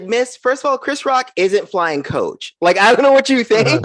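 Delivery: high, exasperated comeback, laughing through it; mock-offended comedian; indignant retort. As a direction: set the lady straight, half laughing. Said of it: "I was like".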